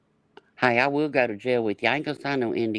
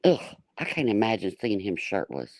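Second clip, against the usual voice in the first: usual speaking voice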